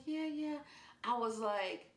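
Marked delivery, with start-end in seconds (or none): 0.1-0.6 high mock reply, lightly acted; imitated sleepy reply. Just-woken Marsha answering her roommate, high imitated voice. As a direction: imitate someone answering in a higher voice.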